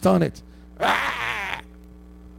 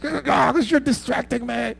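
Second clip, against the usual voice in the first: mock angry parent; 7 semitones higher than usual. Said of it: "you get angry at your kids".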